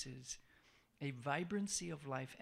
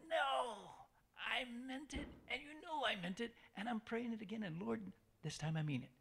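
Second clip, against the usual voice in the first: higher, insistent plea to God; pleading prayer; 6 semitones higher than usual. Shown as a demonstration. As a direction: beg like someone who fell but meant it. Pleading after failing.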